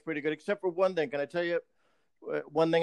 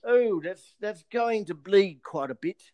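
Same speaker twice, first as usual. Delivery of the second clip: politely apologetic English Batman; cheery English manners while beating someone; British accent. Pretend. British Batman apologising to a thug he's beating. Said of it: "if Batman was British".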